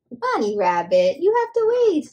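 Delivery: loud, rising high call to a runaway bunny. Pretend. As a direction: shout playfully after the toy rabbit. Calling after runaway toy bunny.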